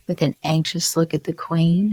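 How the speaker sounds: plain reading voice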